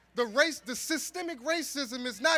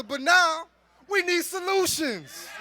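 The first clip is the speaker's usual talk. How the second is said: usual speaking voice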